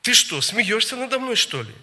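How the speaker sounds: indignant challenge, pitch jumping up; retold angry questions, rising in force; aggressive. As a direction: snap back offended, mocking an aggressive woman. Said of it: "так агрессивно".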